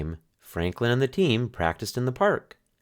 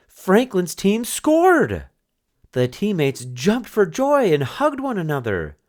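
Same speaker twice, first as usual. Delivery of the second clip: excited narration; 12 semitones higher, 5 dB louder than usual. A narrator's reading. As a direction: announce the goal like a cheering crowd. Team finally scores.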